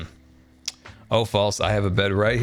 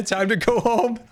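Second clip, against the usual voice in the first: ordinary talk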